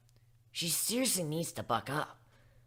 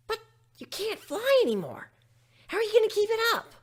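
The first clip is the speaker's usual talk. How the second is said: much higher, quick worried little filly; 5 dB louder than usual. Acted. Filly asking the griffin about flying, high and quick. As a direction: ask fast like a concerned child.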